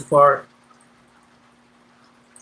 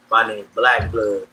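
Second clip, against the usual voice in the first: much lower, slow deep voice introducing himself; imitating a deep-voiced young homie from juvie; 5 semitones lower, slower than usual. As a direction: lean low and heavy on the name. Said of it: "he got a deep ass voice when we was young".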